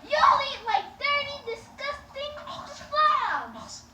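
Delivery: high mock disgust, dragging out the last words; sarcastic insult. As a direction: tease them like a disgusted host. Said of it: "Being sarcastic".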